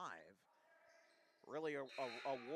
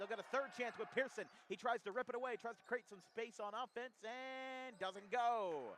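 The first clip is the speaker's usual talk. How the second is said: auctioneer; 7 semitones higher, 3 dB louder than usual. Real, not acted. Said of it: "I was sounding like an auctioneer".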